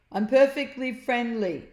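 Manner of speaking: friendly loud hello; Slime creature. A narrator's reading. Slime voiced.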